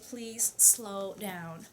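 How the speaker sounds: very slow, drawn-out please slow down; asking slowly. Retold or imitated. Talking to her spirit guides, a drawn-out slow request. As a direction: stretch each word out as if asking patiently. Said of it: "I'll tell them telepathically".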